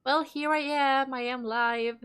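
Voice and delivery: usual speaking voice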